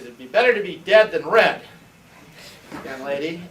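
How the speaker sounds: hammed-up stern reprimand to a student; mock scolding. [name] imitates her old teacher scolding her, mock stern.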